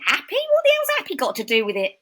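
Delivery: loud, high, incredulous Cockney outburst; Cockney nan. Retold or imitated. Cockney nan answering granddaughter who quit a soap, incredulous. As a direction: scoff it like an indignant Cockney grandmother. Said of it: "my Cockney nan".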